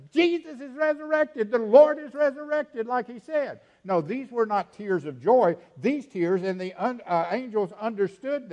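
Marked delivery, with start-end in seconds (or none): 0.1-3.6 raised, high preaching cry; exultant proclamation. Acted. Preacher imagining joy that the Lord is resurrected, exultant proclamation. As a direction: ring it out like joyful news.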